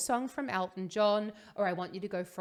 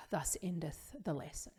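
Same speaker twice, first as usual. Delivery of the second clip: mock-solemn; 3 semitones lower, 8 dB quieter than usual. Mock-biblical sign-off after the analogy.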